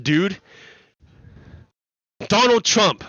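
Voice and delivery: ordinary delivery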